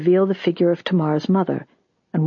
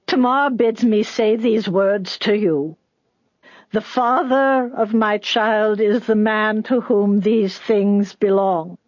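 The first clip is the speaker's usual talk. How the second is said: put-on slow, higher-pitched old woman's voice; old woman messenger; 4 semitones higher, slower than usual. A narrator's reading. Old mother reciting Tamar's words to Judah, slow and high. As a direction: deliver it slowly and high, word by word.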